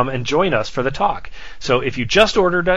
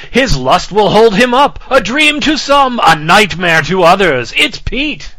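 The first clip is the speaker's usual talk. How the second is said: loud, high, hammy movie-trailer voice; dramatic announcer; 5 semitones higher, 6 dB louder, slower than usual. Acted. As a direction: ham it up like a trailer narrator. Dramatic movie-quote intro.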